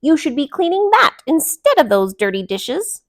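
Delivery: loud high scolding; shouting. A narrator's reading. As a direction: scold them loudly. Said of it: "Delilah shouted".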